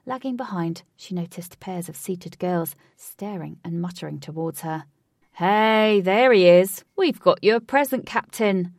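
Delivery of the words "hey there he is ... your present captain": loud rowdy greeting; loud cheerful shout. A narrator's reading. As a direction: boom it out to the captain. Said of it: "said a well-groomed man".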